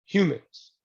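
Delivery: ordinary talk, nothing marked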